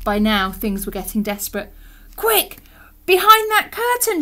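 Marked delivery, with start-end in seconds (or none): 2.2-4.2 high, loud command; urgent shout. A narrator's reading. Robot steering desperate Alan to hide, urgent shout. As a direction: shout a hurried order. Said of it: "shouted Robot".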